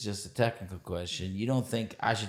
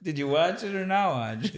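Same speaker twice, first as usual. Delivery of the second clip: exasperated; 10 semitones higher, 3 dB louder than usual. Retold or imitated. Mock exasperated quote.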